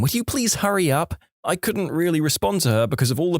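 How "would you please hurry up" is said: higher-pitched urging, lightly voiced mother; a touch of exasperated pleading; impatient plea. A narrator's reading. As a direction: press politely through the door, pitched up. Impatient mother at bathroom door.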